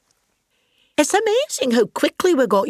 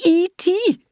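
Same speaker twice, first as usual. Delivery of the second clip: high, incredulous gasp of a name; can't believe who walked out; stunned squeak; shocked disbelief; 11 semitones higher than usual. Acted. Disbelief at the alien reveal.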